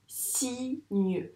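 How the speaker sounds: "Cygne" as si, short pause, gneu; syllable by syllable. Shown as a demonstration. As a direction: sound out "Cygne" in two separate beats. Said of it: "je dis une syllabe".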